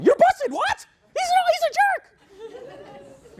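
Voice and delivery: hammed-up woman venting, mock outrage; mock angry girlfriend. Imitating a girlfriend who matches her friend's anger, hammed-up high rant. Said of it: "She gets even more angry".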